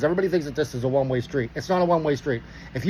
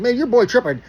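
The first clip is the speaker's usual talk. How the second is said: higher, animated complaint in street slang; neighborhood street talk; 6 semitones higher, 4 dB louder than usual. Retold or imitated. Driver tells officer about his partner, animated street talk. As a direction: retell a casual gripe, pitched up. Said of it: "this is exactly how I say".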